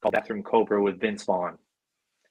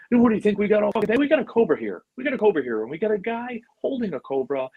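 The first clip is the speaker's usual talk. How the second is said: high, urgent movie-star rant; Vince Vaughn; 11 semitones higher, faster than usual. Retold or imitated. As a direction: take over the set with impatient, pitched-up patter. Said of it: "he just took over".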